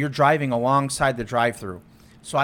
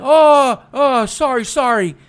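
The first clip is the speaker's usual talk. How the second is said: much higher, louder flustered apology; clueless driver; 8 semitones higher, 6 dB louder, slower than usual. Retold or imitated. Mimicking the driver's flustered apology, high and loud. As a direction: squeak out the sorries. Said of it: "And he's like".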